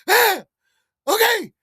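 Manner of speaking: high, loud, alarmed okay; startled worker. Retold or imitated. Restaurant worker startled by a bloody customer, high alarmed voice. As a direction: squeak it out like a scared cashier.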